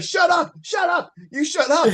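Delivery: high-pitched mock screaming; retold yelling. Will yelling back at Vlad in the background, mock screaming. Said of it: "like, yelling at him".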